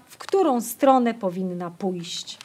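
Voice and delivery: plain reading voice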